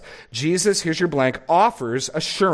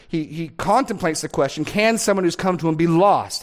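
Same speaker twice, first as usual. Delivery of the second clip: normal talking voice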